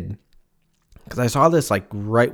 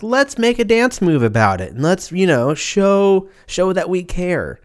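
mocking Americans; 8 semitones higher, 3 dB louder than usual. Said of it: "we're like".

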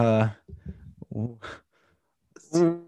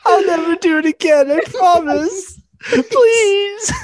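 laughing plea; 14 semitones higher, 7 dB louder, slower than usual. Real, not acted. Sorry for making them play.

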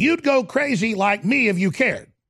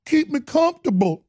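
higher, put-on governor's voice; mocking the governor; 5 semitones higher than usual. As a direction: mimic him, smug and prim. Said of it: "I wonder if he'd say".

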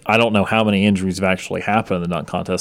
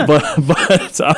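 laughing; 8 semitones higher, slower than usual. Real, not acted. Laughing at his own accent.

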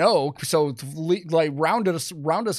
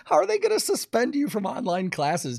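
high, laughing through the words; laughing disbelief; 12 semitones higher than usual. Real, not acted. Host laughing in disbelief at online suspension. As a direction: crack up in disbelief.

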